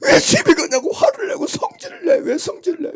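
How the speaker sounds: high-pitched rebuke from the pulpit; high scolding preaching. Real, not acted. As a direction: scold them, pitch climbing.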